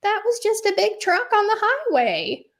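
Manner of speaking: very high singsong mom voice; high-pitched mock reassurance; dismissive mother. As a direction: imitate mom breezily explaining it away. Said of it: "And she's like".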